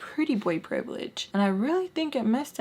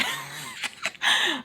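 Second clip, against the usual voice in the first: very high squeal of laughter; amused laugh; 3 dB quieter than usual. Real, not acted. Teller amused by her texted reply, high giggle. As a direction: crack up at your own joke.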